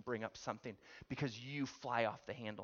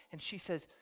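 normal talking voice

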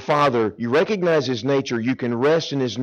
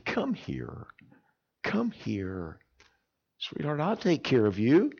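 much softer, hushed and comforting; tender father; 8 dB quieter, slower than usual. Acted. Father softly comforts his crying daughter. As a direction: gently draw the scared child close.

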